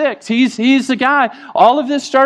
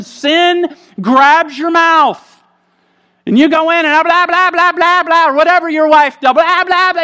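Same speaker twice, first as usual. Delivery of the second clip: mock nagging wife; 8 semitones higher than usual. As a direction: ham up a wife ranting. Mimicking a wife's nagging.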